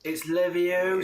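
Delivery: ordinary delivery, nothing marked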